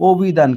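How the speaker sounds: অভিধান opening on rounded o, obhidhan. Shown as a demonstration. Teacher answers own question on reading অভিধান, first vowel as o. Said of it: "আমরা কি পড়ছি?".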